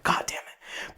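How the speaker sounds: hushed, exasperated aside to yourself; whispered curse. Acted. Passenger typing the address for the driver, whispered curse. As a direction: swear under your breath, fully whispered.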